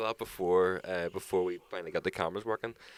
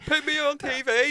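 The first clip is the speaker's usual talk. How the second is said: much higher, loud cheeky call; mock prisoner; 4 dB louder than usual. Friend imitating a prisoner begging to be on TV, high. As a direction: play the heckling inmate.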